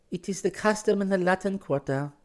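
put-on high-pitched girl's character voice; oddly knowing girl. A narrator's reading. Girl tells the newcomer of Latin Quarter custom, oddly knowing. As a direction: explain it in a high, careful voice. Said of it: "said with a queer light in her eyes".

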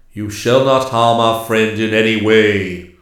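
loud, resonant, forceful order; commanding prohibition. A narrator's reading. Master woodsman defends his friend to the king, loud and commanding. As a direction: forbid it with ringing authority.

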